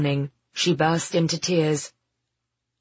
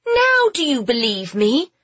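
high, proud challenge; triumphant; 8 semitones higher, 4 dB louder than usual. Acted. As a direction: gloat a little, vindicated. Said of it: "Phoebe asked triumphantly".

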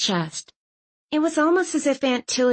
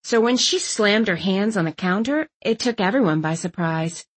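ordinary delivery, nothing marked

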